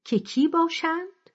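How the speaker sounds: high, rising contemptuous question; mild disdain. A narrator's reading. Elder woman questioning who the bride might be, mildly contemptuous. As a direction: ask it with understated scorn, pitch lifted. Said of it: "با تحقیر پرسید".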